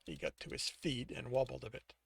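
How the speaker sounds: usual speaking voice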